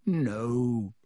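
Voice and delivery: horrified, croaky "no"; croaked plea. A narrator's reading. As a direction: croak it out in dismay. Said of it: "croaked Harry".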